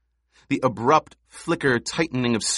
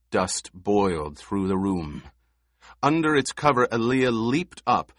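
ordinary delivery

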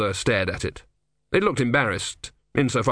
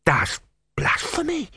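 much higher, scandalized; horrified novice. A narrator's reading. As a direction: gasp out the accusation.

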